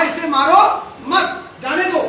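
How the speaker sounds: "इसे मारो" as one order, मत starting the next phrase; pause before मत. Shown as a demonstration. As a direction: break after "मारो", then "मत, जाने दो". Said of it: "कहने का फर्क, शब्द वही है".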